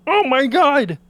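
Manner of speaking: high, loud delighted cry; thrilled reunion shout; excited Kermit. Acted. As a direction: greet an old friend you haven't seen in years. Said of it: "He hears his familiar voice and just goes".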